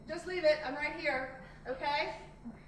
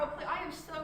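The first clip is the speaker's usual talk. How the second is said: usual speaking voice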